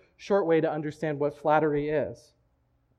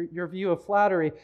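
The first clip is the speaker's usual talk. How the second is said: usual speaking voice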